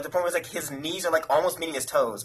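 ordinary talk

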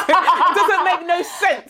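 slow, high, laughing through the words; amused exasperation at the mum's advice; laughing disbelief. Real, not acted.

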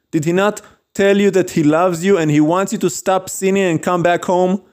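normal talking voice

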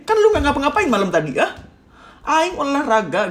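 high, loud, indignant outburst; exasperated protest. Acted. Speaker silently protesting to the partner about exercising, exasperated. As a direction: let the bottled-up retort burst out. Acted inner protest in quarrel.

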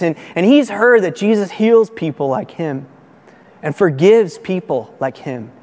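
ordinary talk, nothing marked

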